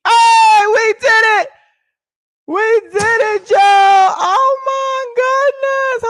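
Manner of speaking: very high, loud victory screams; real elated shouting. Real, not acted. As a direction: scream in triumph as the goal is hit. Goal reached, celebrating.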